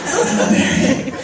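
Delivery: slow, rising, breathy-sounding gush; drawn-out gushing Cinnaberry; gushing stylist. Retold or imitated. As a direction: savor the word like a treat. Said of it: "he's like".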